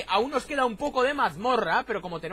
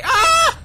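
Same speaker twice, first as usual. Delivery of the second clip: sudden high, loud yell; startled scream; 17 dB louder than usual. Real, not acted. Gamer caught off guard mid-explanation, startled scream. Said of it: "grito por nada".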